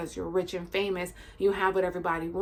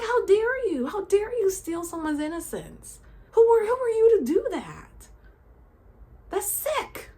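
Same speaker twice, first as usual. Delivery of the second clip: high, indignant, raised disbelief; outraged disbelief; 10 semitones higher than usual. Real, not acted. Ranting to viewers about child abusers, outraged high voice. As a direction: let the outrage climb.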